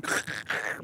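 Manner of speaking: raspy throat choking noise; imitated choking. A narrator's reading. Shy young man startled by the pretty girl's question, imitated choking. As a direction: mimic someone choking on a drink. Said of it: "began to choke".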